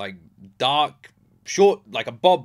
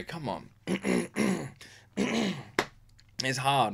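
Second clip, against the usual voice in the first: ordinary talk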